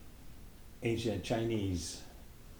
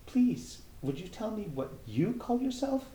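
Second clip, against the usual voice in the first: higher, timid little plea; a small higher voice asking timidly; 4 dB louder than usual. Acted. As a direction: ask shyly, hoping for help. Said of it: "she said timidly".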